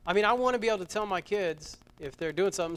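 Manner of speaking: ordinary talk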